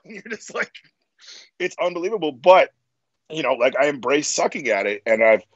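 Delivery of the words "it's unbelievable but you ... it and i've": ordinary delivery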